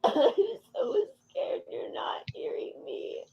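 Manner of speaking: high, whispery sobbed plea; frightened crying. Real, not acted. Woman scared her partner isn't listening, sobbing whisper. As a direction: squeeze it out slowly through sobs.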